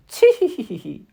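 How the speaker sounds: high, loud mechanical tee-hee giggle; tinny wound-up titter; wind-up doll giggle. Acted. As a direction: giggle like a clockwork toy. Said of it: "Her laughing sounded as if it were wound up".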